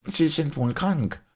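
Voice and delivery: high-pitched, sharp doctor voice. A narrator's reading. Doctor suggesting the priest is ill, sharp. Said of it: "meinte Doktor Simon scharf".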